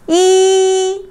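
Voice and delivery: "Yi" long, high and level; first tone. Shown as a demonstration. Teacher practising the word for one, long high flat tone. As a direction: hold "Yi" with no change in pitch. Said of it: "It's kinda long and no change".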